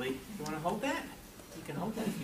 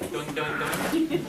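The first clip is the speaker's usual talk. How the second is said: bunny hops; 4 dB louder, much slower than usual. Pretend. Mimicking a hopping bunny.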